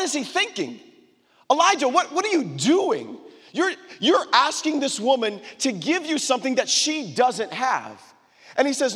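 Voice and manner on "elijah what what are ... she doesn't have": high, emphatic mock disbelief; incredulous challenge. Preacher challenges Elijah over asking a poor widow, incredulous. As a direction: take him to task, pitched high and stretched.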